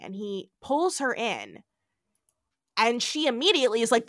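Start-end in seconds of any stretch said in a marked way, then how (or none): none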